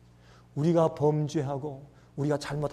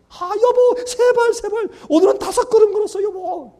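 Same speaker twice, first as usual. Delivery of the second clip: much higher and louder, bursting with delight; overjoyed parent; 11 dB louder than usual. Acted. Parent tells spouse about baby's steps, overjoyed. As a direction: shout it out like a thrilled new parent.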